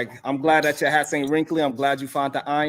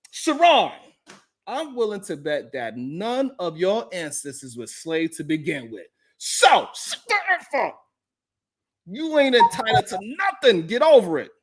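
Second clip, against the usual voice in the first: mock angry read; 6 semitones higher than usual. Reading hostile chat.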